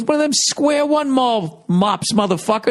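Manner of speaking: high-pitched retold outburst, stretched out; mock Patrice flipping out. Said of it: "he starts flipping out on me".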